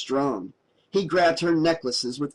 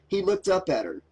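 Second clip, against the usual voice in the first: ordinary delivery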